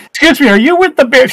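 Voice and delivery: high-pitched put-on girl's voice. Teller mimicking the big girl who came over, high girlish approach. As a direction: lift it up high, mock-polite approach.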